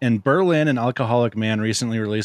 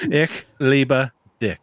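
"Ich liebe dich" slowly, each word drawn out through a laugh; slow repeat; 3 semitones higher, much slower than usual. Shown as a demonstration. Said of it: "It sounds like "eat a dick".

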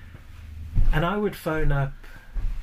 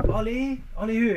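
lightly voiced bartender, pitched up; a hint of puzzled Moe; imitating Moe; 7 semitones higher than usual. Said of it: "And Mo will go".

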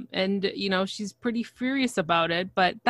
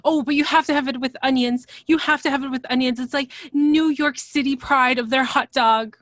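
higher, fast insistent gushing; excited Darcy; 6 semitones higher, 5 dB louder than usual. Retold or imitated. Mimicking Darcy insisting on onions, higher and fast. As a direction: insist eagerly, words tumbling out. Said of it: "And he's like".

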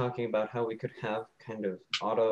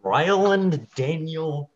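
slow, loud, high-pitched name call; drawn-out full name; 6 semitones higher, 5 dB louder, much slower than usual. Pretend. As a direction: stretch out each name, raised voice. Teasing with the disputed full name.

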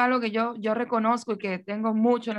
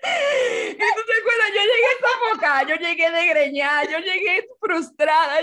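much higher, laugh-crying; recalling arriving frazzled, laughing; laughing through tears; 7 semitones higher, 4 dB louder than usual. Real, not acted. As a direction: wail it out laughing.

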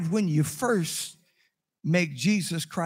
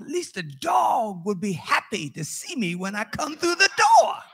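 rising to a high, loud finish; mock-aggrieved outburst, climbing in pitch; exasperated complaint; 5 semitones higher, 4 dB louder than usual. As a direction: build to a pleading shout on the last words. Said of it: "I said".

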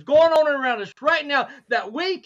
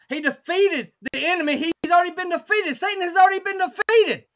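building, higher-pitched sermon proclamation; triumphant preaching; 6 semitones higher than usual. Real, not acted. Preacher declaring Satan already defeated, triumphant preaching. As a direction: proclaim it with rising conviction.

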